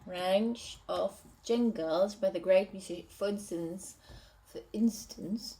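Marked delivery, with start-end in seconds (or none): none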